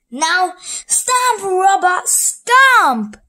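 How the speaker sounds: high, loud villain order; villain ordering his robot to stomp; loud command. Acted.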